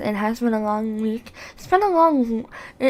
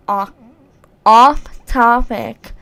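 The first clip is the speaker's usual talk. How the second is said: stumbling slowly; 10 dB louder, slower than usual. Real, not acted. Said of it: "I can't even talk".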